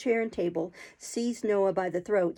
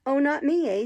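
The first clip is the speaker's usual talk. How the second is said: scornful sneer, pitched up; 5 semitones higher, 6 dB louder than usual. A narrator's reading. Noah sneering back at Oliver. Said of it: "sneered Noah".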